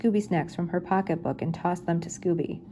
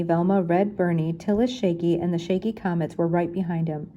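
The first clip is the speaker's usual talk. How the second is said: plain reading voice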